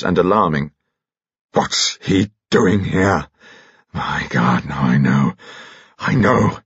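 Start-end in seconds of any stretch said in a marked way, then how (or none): 1.5-6.6 slow, halting, as if dawning on him; stunned horror. Acted. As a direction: gasp it out in dismay, haltingly. Carados horrified on seeing Dimitri.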